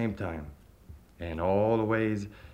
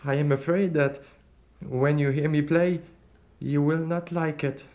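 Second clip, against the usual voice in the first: higher, louder anxious voice; worried plea; 5 semitones higher, 3 dB louder than usual. A narrator's reading. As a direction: nervously warn the stranger. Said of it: "he said".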